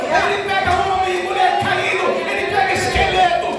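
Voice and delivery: high, loud preaching at full force; fiery preaching. Acted. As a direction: preach it to the rafters, building. Preaching crescendo.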